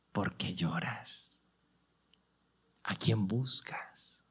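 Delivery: slow, soft and low, as if comforting; gentle Jesus voice. Acted. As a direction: ask her tenderly why she weeps. Jesús pregunta a María. (Jesús pregunta a María con voz lenta, suave y tierna)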